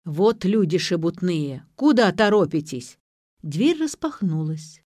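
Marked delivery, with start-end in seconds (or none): none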